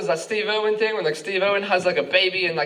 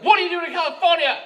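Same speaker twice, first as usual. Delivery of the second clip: high-pitched mock shout; yelling policeman; 11 semitones higher than usual. Policeman yelling at the confused tourist, mock shout. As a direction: bellow the question as an exasperated cop, hammed up. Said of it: "He started yelling at me".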